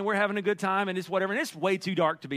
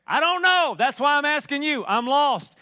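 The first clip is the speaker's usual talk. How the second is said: higher, louder frustrated answer; lost dad shouting back in the maze; exasperated loud reply; 6 semitones higher, 5 dB louder than usual. Retold or imitated.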